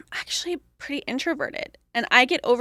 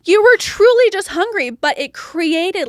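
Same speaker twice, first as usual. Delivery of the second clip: usual speaking voice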